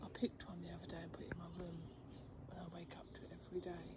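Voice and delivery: hushed, breathy whisper. Real, not acted. Whispering to viewers among the flowers. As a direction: murmur it softly to the camera.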